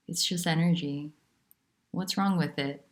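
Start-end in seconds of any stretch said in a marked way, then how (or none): none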